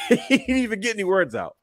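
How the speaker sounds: high-pitched, laughing through the words; barely contain the laughter; helpless amusement at a retold gag; real laughing. Real, not acted. Cracking up at own retelling.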